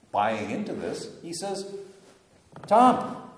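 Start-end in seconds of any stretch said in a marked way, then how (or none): 2.7-3.3 calling out. Retold or imitated. Jesus calling out to Thomas.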